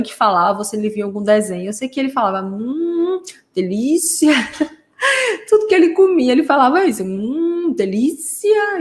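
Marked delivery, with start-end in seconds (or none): 2.5-4.3 long high "hum", then a lilting "delícia"; drawn-out intonation. Retold or imitated. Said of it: "bem prolongado, com a entonação". 7.0-8.8 long high "hum", "delícia" stretched slowly and pitched up; drawn-out intonation. Retold or imitated. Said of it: "bem prolongado, com a entonação".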